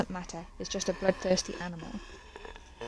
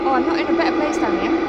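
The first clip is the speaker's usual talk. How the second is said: loud, high alarmed cry; dismayed outburst; 6 semitones higher, 10 dB louder than usual. Real, not acted. Dismay at location.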